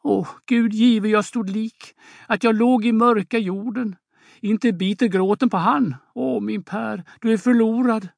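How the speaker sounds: mother crying over her lost son; weeping. Acted. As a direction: wail to God in tears. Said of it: "Åse gråter".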